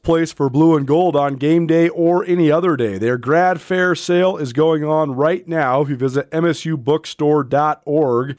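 radio host reading a sponsor spot; live ad read. Acted. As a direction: pitch the bookstore sale to listeners.

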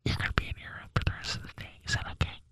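rapid, hushed mock threat; close mom whisper. Imitating mom scolding her kid, rapid whisper. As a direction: whisper punishments quickly into the ear. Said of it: "she would whisper so close to my ear".

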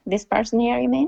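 playful alter ego voice; other persona voice. Pretend. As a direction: slip into another character. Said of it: "she doesn't come out very often".

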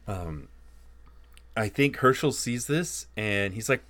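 ordinary delivery

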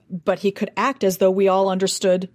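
ordinary delivery

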